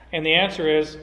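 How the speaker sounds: ordinary talk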